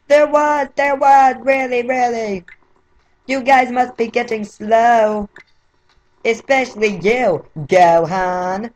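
high, piping cartoon voice; high, cheeky anime dub voice; Japanese voice-actor impression. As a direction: taunt them in a squeaky anime voice. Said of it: "I'm gonna do the Japanese voice".

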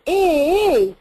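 ee pushed from below, larynx up; pressed ee from low down; low-placed ee. Shown as a demonstration. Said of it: "if I do that sound, I go".

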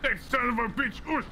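sharp, angry curse; angry outburst. Real, not acted. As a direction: spit it out in outrage. Game character steals their money.

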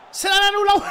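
high, loud gloating cry; gleeful shout. Real, not acted. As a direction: crow over the disallowed goal. Goal disallowed, gloating. (grito eufórico, agudo y fuerte)

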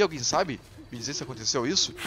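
normal talking voice

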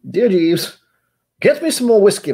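loud, very high put-on voice; mock master voice. Host ordering imaginary Jeeves, hammed-up high voice. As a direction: call to a butler, hammed-up. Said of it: "Where's my voice going with this?".

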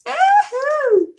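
high, loud woohoo; thrilled whoop at the new makeup; excited cheer. Real, not acted. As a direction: squeal with delight. Excited cheer opening package.

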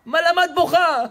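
high, loud irritated question; mock exasperation. Storyteller as friends fed up with the crying, exasperated. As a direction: demand it in acted annoyance. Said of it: "עד שעצבנו אותה, אמרו לה".

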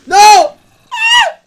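high, loud shriek; terrified scream. Real, not acted. Gamer as the creeper explodes, screaming. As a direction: scream as it blows.

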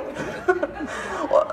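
soft airy real laughter; breathy laugh. Real, not acted. Reader still amused by the book, airy laugh.

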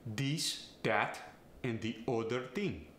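This, that and other said dis, dat, odder; Farsi accent. Shown as a demonstration. Coach demonstrating a Farsi accent, dis, dat and de odder ting. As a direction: Farsi accent with D for every voiced th. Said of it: "replace the T H with either a D or a T".

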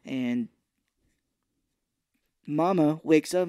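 ordinary talk, nothing marked

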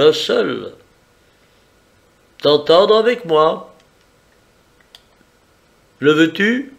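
smug and in control, lifted voice. Acted.

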